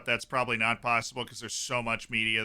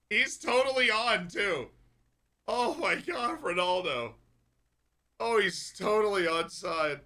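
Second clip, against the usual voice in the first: high incredulous protest; 7 semitones higher than usual. Real, not acted. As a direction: protest it in stunned disbelief. Reacting to offside call.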